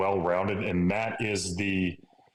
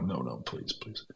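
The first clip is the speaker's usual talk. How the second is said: lower, softer begging that fades to nothing; quiet pleading; 5 semitones lower, 9 dB quieter than usual. Retold or imitated. Driving to his lift as the cry comes, softly begging. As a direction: plead under your breath.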